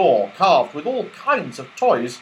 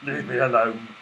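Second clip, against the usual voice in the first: growling; 6 dB louder than usual. Acted. Moonface pressed in the middle, growling at the pixie. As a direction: growly grumble. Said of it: "Moonface growled deeply".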